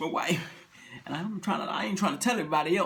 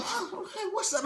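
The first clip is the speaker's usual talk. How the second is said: high, soft just-woken wife; drowsy and high, barely awake; sleepy wife voice; 12 semitones higher, 7 dB quieter, slower than usual. Acted. Wife just waking, asks her husband what's up.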